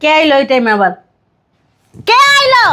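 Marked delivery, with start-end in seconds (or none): 2.1-2.7 high, drawn-out holler; raised voice, calling to the door; yelling out. Acted. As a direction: call out to whoever has come. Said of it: "চিল্লাইতাছো কে".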